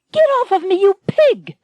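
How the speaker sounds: high, loud heroine's cry of disgust; Anne shouting. Acted. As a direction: shriek it at him like a cornered heroine. Anne fights off Max.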